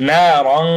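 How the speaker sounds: loud না রং with long vowel and held "ng"; না রং recited with a nasal hum; nasal ghunnah. Shown as a demonstration. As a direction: let the end of না রং ring in the nose. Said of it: "নাকের ভিতর থেকে গুন্না".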